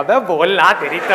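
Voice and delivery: sudden loud heckle; acted outburst at the speaker; exasperated crowd member. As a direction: burst out like a fed-up listener. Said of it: "लोग बौखला जाएंगे".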